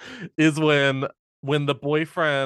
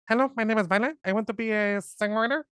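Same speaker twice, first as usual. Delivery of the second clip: mock [name]; 7 semitones higher, faster than usual. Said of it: "What if [name] played Violet?".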